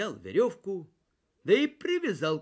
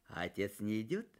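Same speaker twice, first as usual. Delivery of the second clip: usual speaking voice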